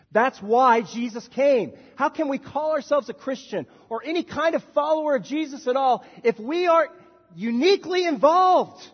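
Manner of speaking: high, loud, slow preacher's cry; fiery pulpit shouting; full-force preaching. Acted. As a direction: rise up and proclaim it to the congregation.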